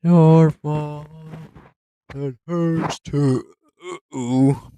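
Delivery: loud, hammed-up dramatic lines; dramatic shouting. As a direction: belt it out melodramatically. Loud dramatic lines mid-game.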